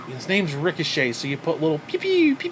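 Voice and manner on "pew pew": high mock laser zaps; pew pew gun sounds. Mocking Ricochet's entrance noises, high zaps. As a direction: zap it like a toy blaster. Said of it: "Ricochet sounds".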